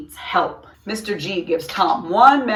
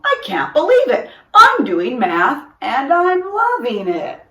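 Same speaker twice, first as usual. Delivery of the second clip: delighted; 7 semitones higher, 6 dB louder than usual. A narrator's reading. Tom thrilled about math.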